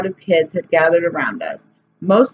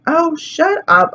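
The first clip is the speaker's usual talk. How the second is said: much higher, slow scornful snap; exasperated; 10 semitones higher, slower than usual. Acted. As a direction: cut her off with disgust. Boy snaps at crying girl.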